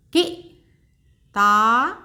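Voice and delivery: किताब stretched very slowly, each syllable held long; slow teacher blending; slow sounding out. Shown as a demonstration. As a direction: draw out किताब for a learner. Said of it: "एक बार फिर से दोहराते हैं".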